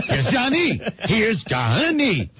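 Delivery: high, laughing Here's Johnny, stretched slow; mock retake of the Shining line; repeated Jack impression. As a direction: ham up the axe line again. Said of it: "Take a hundred sixty-three".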